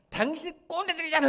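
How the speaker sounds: high, loud acted outburst; angry retort. Preacher acts out the provoked young doctor, angry retort. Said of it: "얘가 화가 나서".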